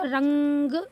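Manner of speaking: रंग with a long hummed nasal, ग set after it; drawn-out anusvara. Shown as a demonstration. Teacher reading the word with its dot, drawn-out anusvara. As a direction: hold the nasal in रंग slowly. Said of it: "छोटी अंग का उच्चारण क्या".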